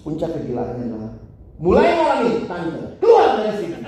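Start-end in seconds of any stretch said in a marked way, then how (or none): 1.6-3.8 high, loud order that breaks into a laugh; mock eviction order. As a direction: order the landlady out, half laughing. Boarder evicting the landlady.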